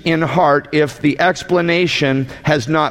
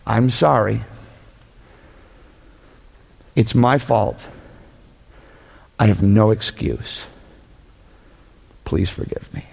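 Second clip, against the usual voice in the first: sincere apology; 6 semitones lower than usual. Shown as a demonstration. Preacher modelling the one passing apology, sincere and hushed. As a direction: sound truly sorry, dropping lower each line. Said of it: "only one apology that reflects a repentant heart".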